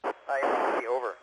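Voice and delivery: distant radio voice; faint voice over the radio; radio transmission. Real, not acted. Astronaut over ISS radio link.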